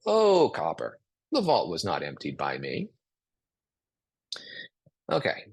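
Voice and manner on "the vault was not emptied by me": low, calm and soothing, no blame; calm, defusing. Shown as a demonstration. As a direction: say "the vault was not emptied by me" calmly to defuse it. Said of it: "This really diffuses a situation".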